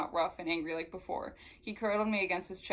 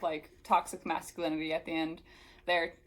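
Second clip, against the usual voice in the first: plain reading voice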